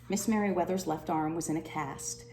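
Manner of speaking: usual speaking voice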